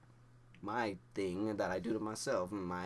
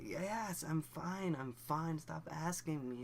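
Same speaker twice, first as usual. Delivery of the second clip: higher-pitched defensive protest; exasperated insistence; 7 semitones higher, slower than usual. Retold or imitated. Nervous teen answering partner's repeated check, high and defensive. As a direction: insist it, fed up with being asked. Retorting to repeated are-you-okay.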